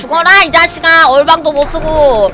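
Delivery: loud angry taunts; yelling. Real, not acted. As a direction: shout down the enemy. Fighting in game, yelling.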